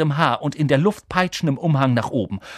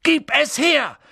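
high, loud yell; shout; 6 dB louder, slower than usual. Acted. Harry shouting at Malfoy for the ball. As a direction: shout it at him. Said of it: "schrie Harry".

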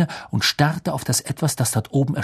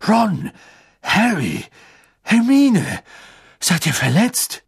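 worried father; 8 semitones higher, 4 dB louder, slower than usual. A narrator's reading. Mr Weasley checks on children.

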